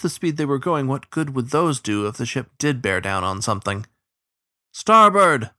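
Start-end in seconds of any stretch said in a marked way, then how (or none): 4.7-5.5 loud, high call back; shouted answer. A narrator's reading. Crew answering the captain as they haul oars, shouted. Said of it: "the crew answered".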